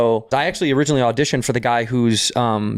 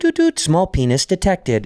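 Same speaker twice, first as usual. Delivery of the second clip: imitated machine beeps, then an announcement; lightly voiced automatic detector with sung-out "doot" beeps; automated door; 6 semitones higher, slower than usual. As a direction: play a sensor door sounding its alert. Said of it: "the door automatically censors how big".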